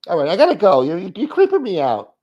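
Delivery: high, loud put-on caller voice; mock creeped-out. Caller in a skit trying to get away, mock creeped-out. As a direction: hurry off the call, comically unnerved.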